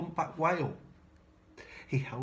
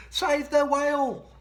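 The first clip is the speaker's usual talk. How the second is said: much higher, louder, slow call; urgent plea; 12 semitones higher, 9 dB louder than usual. Acted. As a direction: announce the message boldly. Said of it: "a silvery trail saying".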